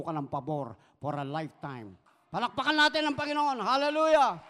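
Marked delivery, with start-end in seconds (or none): 2.3-4.4 loud, high call for praise; rallying shout. Real, not acted. Preacher urging the congregation to applaud the Lord, rallying shout.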